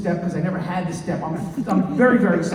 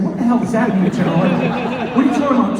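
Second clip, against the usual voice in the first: louder and higher, indignant protest; mock outrage; 6 semitones higher, 7 dB louder than usual. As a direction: act offended at the general. Indignant reply to the general.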